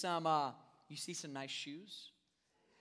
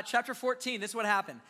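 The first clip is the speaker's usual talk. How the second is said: usual speaking voice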